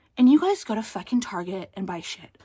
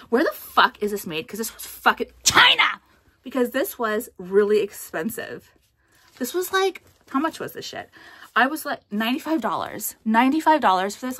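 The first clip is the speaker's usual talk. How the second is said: loud, higher, indignant yelling; outraged rant; 3 semitones higher, 7 dB louder than usual. Real, not acted. Vlogger fumes to viewers over a ninety-five dollar outfit. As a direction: rage at paying so much.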